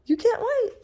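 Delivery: high coaxing question to the dog; high pet voice. Real, not acted. Puppy begging again, high teasing pet voice.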